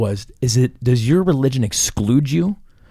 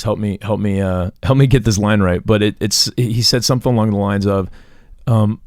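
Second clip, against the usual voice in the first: usual speaking voice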